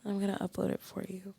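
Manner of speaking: soft teasing whisper. Pretend. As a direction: whisper it like a secret. Teasing ASMR whisper to friend.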